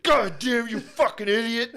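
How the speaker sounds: high, loud mock spaz; imitated angry outburst. Son imitating Phil after being scared, furious outburst. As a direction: explode like someone startled and furious. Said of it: "he fucking spazzed".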